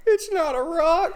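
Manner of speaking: squeaky teasing voice; mock it in a high squeak. Mocking the excuse.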